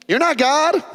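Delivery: high, pointed rebuke; sharp mock rabbi retort; rabbi's retort. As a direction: put him in his place. Rabbi's implied retort to questioner.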